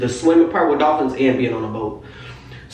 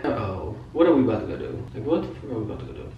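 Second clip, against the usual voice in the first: retold worry under the breath, much softer; wary aside; 4 semitones lower, 8 dB quieter, much faster than usual. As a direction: say it quietly to yourself, uneasy.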